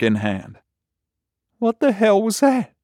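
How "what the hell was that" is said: very high, bewildered voice; stunned deputy, pitch way up; shell-shocked deputy. Acted. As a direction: ask in high-pitched dazed disbelief. Shell-shocked deputy asks his partner.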